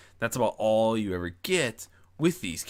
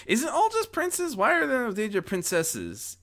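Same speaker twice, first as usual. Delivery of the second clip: surprised. Real, not acted. Mock indignant question.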